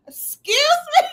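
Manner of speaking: mock shocked daughter. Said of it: "She was like".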